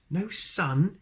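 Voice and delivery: high, loud astonished question; astonished exclaim. Acted. Wizard amazed at a land without sun, exclaiming. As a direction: gasp it out in disbelief. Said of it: "exclaimed the wizard".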